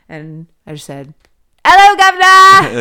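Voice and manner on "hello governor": loud, high mock British holler; British accent. Mocking [name]'s British accent, loud hammed-up British shout. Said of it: "she's doing the British accent".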